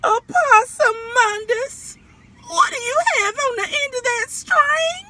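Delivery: high, loud, wailing mother's shout; high crying mother's yell; mama yelling. Acted. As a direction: holler it up high like an upset mama. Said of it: "and she said".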